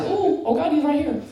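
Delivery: high retold gasp of awe; awed fear. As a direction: act out being frightened by a holy presence. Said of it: "I know what they felt".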